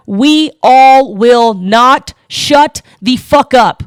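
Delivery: slow, word-by-word angry shout; loud, drawn-out refusal to be silenced; defiant yell. Real, not acted. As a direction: hammer out each word separately. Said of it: "Would you like me to be fucking louder for you?".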